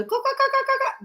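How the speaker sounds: high imitated kookaburra laugh. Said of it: "they're laughing".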